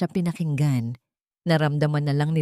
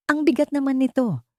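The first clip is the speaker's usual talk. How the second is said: complaining; 8 semitones higher than usual. Acted. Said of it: "reklamo ni Kidlat".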